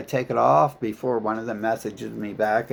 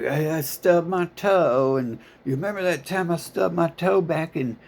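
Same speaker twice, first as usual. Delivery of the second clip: mocking whiny veteran; 8 semitones higher, 4 dB louder than usual. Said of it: "have to call me up and be like".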